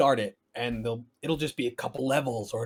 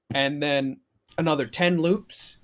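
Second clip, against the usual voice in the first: ordinary talk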